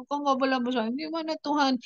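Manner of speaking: high, pleading through tears; crying prayer. Retold or imitated. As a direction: plead with God again. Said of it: "Ku bilang begitu". (doa memohon sambil menangis)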